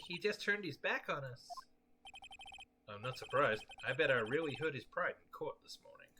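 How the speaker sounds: normal talking voice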